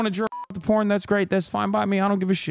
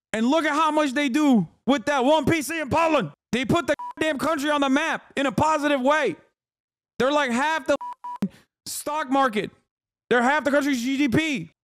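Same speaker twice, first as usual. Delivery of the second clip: hyped praise; 6 semitones higher than usual. Real, not acted. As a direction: rave about them like a fanboy. Hyping CD Projekt Red.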